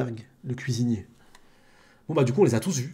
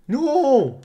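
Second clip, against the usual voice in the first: shrill high cry of no; dubbed character's cry; 12 semitones higher, 6 dB louder than usual. Acted. Streamer dubbing the anime cries no in a high voice.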